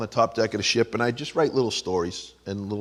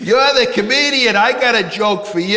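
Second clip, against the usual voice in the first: higher, louder eager tourist voice; eager passenger voice; 10 semitones higher, 7 dB louder than usual. Retold or imitated. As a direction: play a pushy excited passenger.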